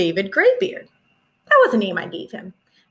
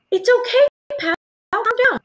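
soothing; 12 semitones higher, 4 dB louder than usual. Acted. Soothing an excited chimp.

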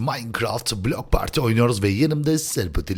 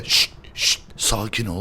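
soft, lowered shushing; hushed self-calming; 6 semitones lower, 6 dB quieter than usual. Real, not acted. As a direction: hush yourself down, low and soft. Kendini sakinleştirmeye çalışıyor. (alçak sesle yatıştırarak)

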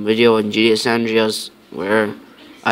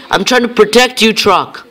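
higher and louder, exasperated pleading; yelling at a dumb game truck that keeps wandering off; frustrated; 10 semitones higher, 5 dB louder than usual. Real, not acted. Gamer yells at the fire truck he must guard, frustrated. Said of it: "I'm getting pissed off now".

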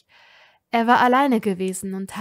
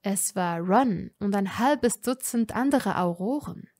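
ordinary delivery

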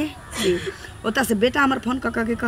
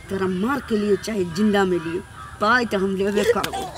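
ordinary talk

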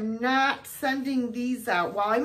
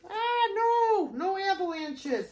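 surprised; 10 semitones higher than usual. Real, not acted. Finds avalanche cards.

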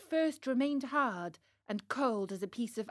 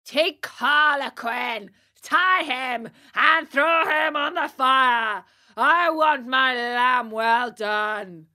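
loud, drawn-out ogre's shout; roaring command, slow and rough; hoarse yell; 8 dB louder, slower than usual. Acted. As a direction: bellow orders hoarsely at your officers. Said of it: "yelled at them in a hoarse voice".

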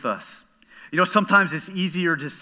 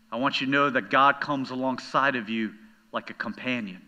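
normal talking voice

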